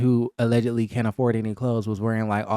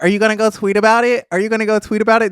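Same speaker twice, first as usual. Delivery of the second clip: higher, fast, louder mocking taunt; taunting Bria; 8 semitones higher, 5 dB louder, much faster than usual. Bria taunting Preston in the house argument, high and fast. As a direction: needle him, rattling it off. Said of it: "And then Bria was like".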